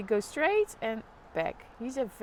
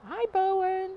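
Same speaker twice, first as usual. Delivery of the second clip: excited greeting; 10 semitones higher, 5 dB louder than usual. Real, not acted. Repeats greeting to child.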